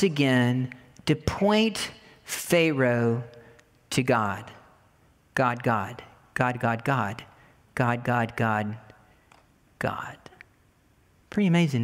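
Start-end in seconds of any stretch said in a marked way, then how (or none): 5.3-10.2 soft, low, fading out; dwindling murmur of God; fading repetition. Acted. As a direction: let each God fall quieter. Repeating softly, fading.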